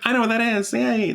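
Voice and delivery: high delighted recognition; excited squeal. Retold or imitated. Spotting her own station on screen, excited squeal. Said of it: "I was like".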